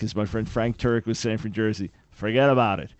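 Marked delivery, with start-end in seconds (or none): 2.1-2.9 put-on a light New York accent on forget about it, slightly louder. Host quoting New York friend, mild New York accent. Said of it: "I just don't have the thick New York accent".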